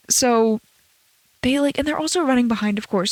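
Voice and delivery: ordinary talk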